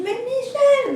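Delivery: high, loud delighted call; surprised greeting. Retold or imitated. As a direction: call out a name in glad surprise. Greeting surprised friend in street.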